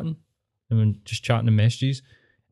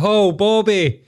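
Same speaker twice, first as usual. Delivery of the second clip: much higher, slow mocking nag; mocking neighbour; 8 semitones higher, slower than usual. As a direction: squeak out the neighbour's scolding, teasing Bobby. Mimicking neighbour's stepdad.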